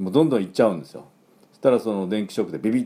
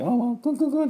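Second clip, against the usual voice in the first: much higher, excited doggy whimpers; imitated dog whimper. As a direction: mimic a dog whining eagerly. (犬の甘え鳴きの真似)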